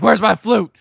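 loud, high demand; mock angry demand. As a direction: shout it like a wronged gangster. Retelling [name]'s angry demand.

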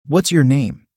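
ordinary delivery

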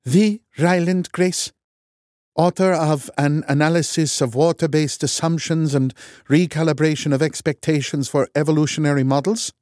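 stunned Norwegian academic; Norwegian scientist, incredulous. A narrator's reading. Loken voiced, Norwegian accent.

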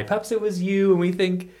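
ordinary talk, nothing marked

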